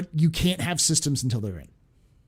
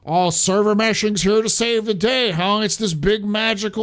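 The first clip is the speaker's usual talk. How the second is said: mocking people; 5 semitones higher, 4 dB louder than usual. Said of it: "People go".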